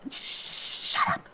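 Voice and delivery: high, squealed shut up; squealing outburst. Real, not acted. Streamer squealing at a shocking line in the book. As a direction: squeal at the scene.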